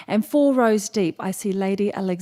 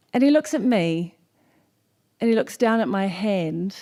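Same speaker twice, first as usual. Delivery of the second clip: ordinary talk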